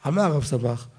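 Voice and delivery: ordinary talk, nothing marked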